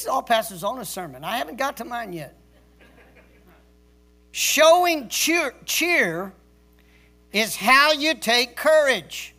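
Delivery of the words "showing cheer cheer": Showing drawn out high, then cheer; stretched emphasis on cheer. Shown as a demonstration. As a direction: preach the word cheer slowly. Pastor drawls the key phrase.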